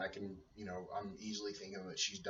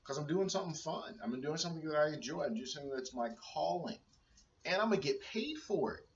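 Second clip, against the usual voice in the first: excited; 7 semitones higher, faster than usual. Retold or imitated. Retelling joy at first paycheck.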